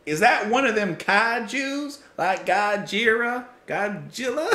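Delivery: slow, high, hammed-up country drawl; country accent. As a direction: stretch it out in a loud mock country accent. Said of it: "like, a country accent".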